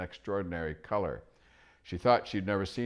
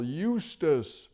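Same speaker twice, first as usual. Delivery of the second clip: high, louder hooting owl; 10 semitones higher, 6 dB louder than usual. Acted. Owl politely hooting to the deaf dwarf. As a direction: hoot up at a deaf old dwarf.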